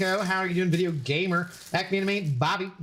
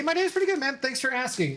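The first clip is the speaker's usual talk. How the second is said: high chirpy answer; 8 semitones higher, faster than usual. Acted. Answers own question in other voice.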